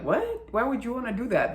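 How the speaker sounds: high-pitched, baffled "what?", then rushed question; disbelieving, quick retort; mock incredulous. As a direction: react like a baffled brother, pitch jumping up. Brother reacts to bad idea.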